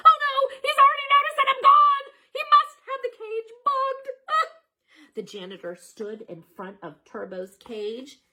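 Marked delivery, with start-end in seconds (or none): 0.1-4.5 very high, loud squeaky panic; hamster panic. Acted. Hamster panics that the janitor bugged his cage. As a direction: squeal it in terror.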